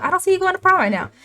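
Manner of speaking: high, rapid mock scolding; stern repeat. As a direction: repeat it fast and high, laying down the law. Dad repeats refusal after her What.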